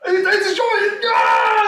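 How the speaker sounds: very high, loud whoop of joy; high roaring cheer; overjoyed shout. Acted. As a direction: burst out in wild celebration. Crusader erupts in joyful cheering.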